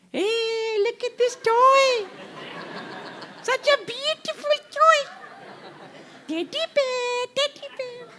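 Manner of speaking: very high, drawn-out sing-song mother; mother's baby talk. Acted. Mother shows crying child a toy, high cooing baby talk. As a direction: coo in falsetto to distract a baby.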